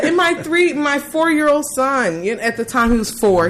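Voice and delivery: ordinary delivery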